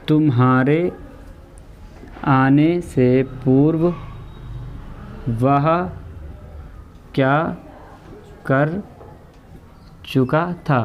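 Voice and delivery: very slow, one word at a time with long pauses; slow dictation. Shown as a demonstration. Teacher dictating the sentence to students, slow word by word. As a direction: let each word land so the class can write it. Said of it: "teacher reads then dictates".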